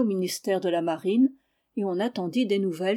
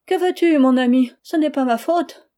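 much higher, louder tearful plea; 6 semitones higher, 8 dB louder than usual. Acted. Wife answers her husband tearfully, high and loud. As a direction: near tears, giving in. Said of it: "avec des larmes dans les yeux".

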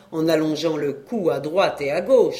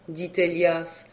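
plain reading voice

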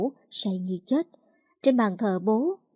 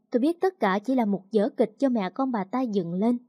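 ordinary delivery, nothing marked

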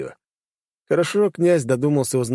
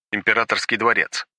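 ordinary delivery, nothing marked

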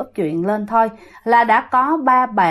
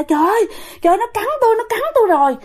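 very high acted wail of complaint; pained crying out; 11 semitones higher, much faster than usual. Great-grandmother bitten by the ghost, crying out in pain. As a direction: cry out as if just bitten, pitch way up. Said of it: "bà cố mới la".

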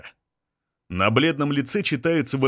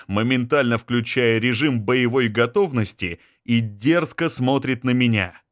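usual speaking voice

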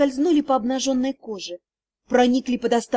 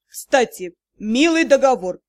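drip with sarcasm; sarcastic; 2 semitones lower, 4 dB louder than usual. A narrator's reading. Magistr mocking the heroine about the contract.

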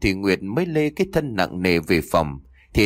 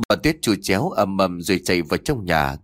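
ordinary delivery, nothing marked

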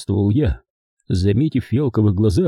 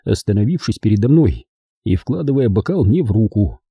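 ordinary delivery

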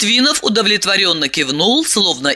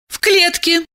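eager agreement; 13 semitones higher than usual. Acted. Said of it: "поддержала аллегорию Диана".

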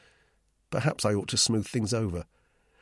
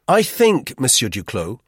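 firm, raised first word; 5 semitones higher, 8 dB louder than usual. A narrator's reading. Narrator intervenes with Duclos, firmly. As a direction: cut in firmly on the quarrel. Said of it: "I said firmly".

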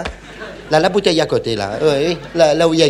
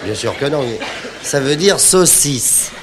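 ordinary delivery

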